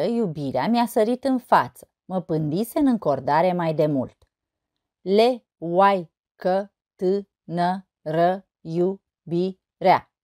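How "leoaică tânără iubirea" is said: slow, a gap after every syllable. Shown as a demonstration. Teacher counting syllables of a poem, syllable by syllable. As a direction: break the verse into separate syllables. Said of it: "Nouă silabe".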